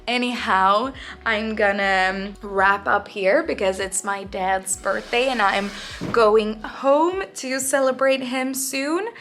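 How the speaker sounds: usual speaking voice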